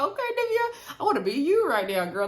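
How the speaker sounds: high squealing "Okay, Nivea" that settles back down; envious squeal. Retold or imitated. Reacting to Nivea being fed oysters, playful envious squeal. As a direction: squeal with playful envy at her friend. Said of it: "I was like".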